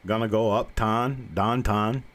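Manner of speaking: slow Pittsburgh "downtown" with a flat ah; Pittsburgh accent, slow. Shown as a demonstration. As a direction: lightly do a western Pennsylvania local. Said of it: "western part of the state".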